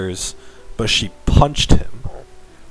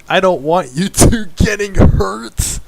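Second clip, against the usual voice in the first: higher, slow pleading heroine voice; loud plea; 10 semitones higher, 4 dB louder than usual. A narrator's reading. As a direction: beg them both to stop, slowly and high. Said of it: "Big Pasta said loudly".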